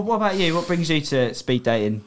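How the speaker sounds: ordinary talk, nothing marked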